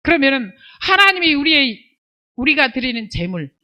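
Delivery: normal talking voice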